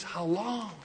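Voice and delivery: soft, drop right down; hushed plea. Acted. Saints looking at a wicked world ask Jesus, hushed plea. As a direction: let the question fall away into quiet. Saints' weary prayer acted out.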